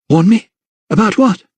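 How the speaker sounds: high, tense questions; alarmed questioning. A narrator's reading. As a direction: demand an answer in alarm. Frightened Lydia questions the vicar.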